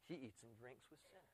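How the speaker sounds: very hushed, low gossiping aside; disapproving murmur. Acted. Critics gossiping about who Jesus eats with, hushed disapproval. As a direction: mutter it behind his back.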